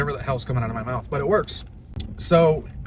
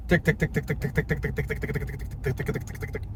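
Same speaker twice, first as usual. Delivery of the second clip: rapid ticking; 5 dB quieter than usual. Shown as a demonstration. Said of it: "I started saying that myself".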